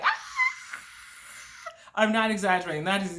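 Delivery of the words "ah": long trailing scream; comic yell of tumbling through the air; imitated falling yell. Skydiver pushed out of the plane, imitated scream. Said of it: "just yelling, like".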